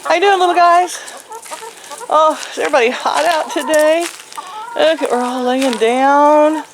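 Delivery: high, sweet cooing; high pet-talk voice for the little guys. Real, not acted. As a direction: talk to them like little babies. Cooing to baby animals.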